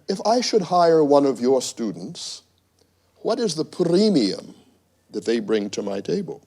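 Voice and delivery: put-on British boss's emphatic English accent. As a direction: pose the challenge in an English accent. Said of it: "with that English accent".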